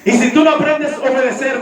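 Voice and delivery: ordinary delivery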